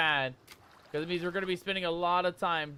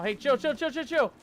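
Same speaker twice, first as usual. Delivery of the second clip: higher, rapid repeated plea; panicky pleading; 6 semitones higher than usual. Real, not acted. Player telling attacker to chill, panicky. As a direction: beg the attacker to back off.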